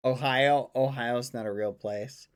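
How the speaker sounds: ordinary delivery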